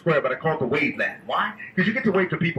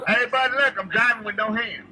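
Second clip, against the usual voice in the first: calling from far away; 6 semitones higher than usual. Real, not acted. Calling to people on shore.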